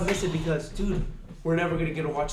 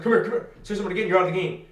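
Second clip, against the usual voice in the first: raised, louder voice, speeding up; imitated referee call; 3 semitones higher, 5 dB louder, faster than usual. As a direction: call a player over like a ref. Imitates referee calling out a player.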